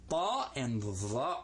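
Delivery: "ba" and "va" slow and drawn out; letter sounds. Shown as a demonstration. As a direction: sound out "ba" and "va" for beginners.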